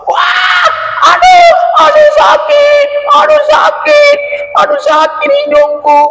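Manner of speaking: very high, loud, slow crying out in pain; shrill animal yelp of pain; pained wailing. Acted. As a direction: wail as the quills sting your nose. Anjani pricked by porcupine quills.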